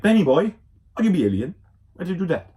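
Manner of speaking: hammed-up grandpa telling off; mock scolding. Grandpa scolding Ben for going alien.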